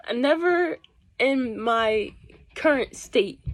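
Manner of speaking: never in my punched high and loud, slowed; drawn-out never, loud and high; stress on never. Real, not acted. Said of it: "I would never in my life".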